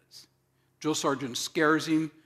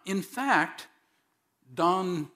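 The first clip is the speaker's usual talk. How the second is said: ordinary talk, nothing marked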